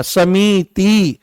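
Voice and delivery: समिति stretched very slowly, syllable by syllable; drawn-out samiti. Shown as a demonstration. Teacher to students, slow spelled-out pronunciation. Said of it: "अगर आप समिति बोलोगे तो फिर इसको ऐसे लिखना पड़ेगा".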